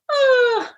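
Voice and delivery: high, open exhale sound; demonstrated breath out. Shown as a demonstration. Host guiding listeners through a breath, demonstrated exhale. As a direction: breathe out audibly with them. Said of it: "Take a deep breath in".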